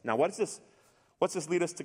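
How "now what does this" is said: ordinary talk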